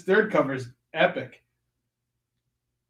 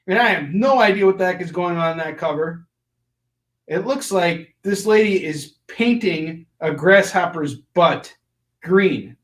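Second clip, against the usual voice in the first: normal talking voice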